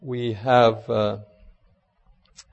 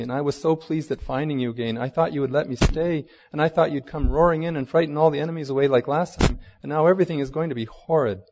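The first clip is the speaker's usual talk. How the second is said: higher, louder storybook lament; a child's disappointment, pouring out in a run; dismayed complaint; 5 semitones higher, 5 dB louder than usual. A narrator's reading. Lucy pours out her disappointment to Aslan, in mild dismay.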